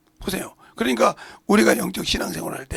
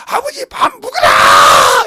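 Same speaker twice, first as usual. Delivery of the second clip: high, very slow, loud call to father across the hills; mountain-village yell; 10 dB louder, much slower than usual. Retold or imitated. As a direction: bellow dinner's ready across the valley. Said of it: "엄청나게 크게 불러야 된대요".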